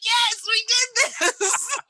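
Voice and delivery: high, drawn-out triumphant cheer. Retold or imitated. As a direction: re-enact a jubilant victory cry, stretched out. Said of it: "we were cheering".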